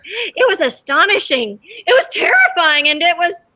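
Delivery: growing storybook amazement; rising wonder. A narrator's reading. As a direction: build the awe line by line. Narrator builds wonder.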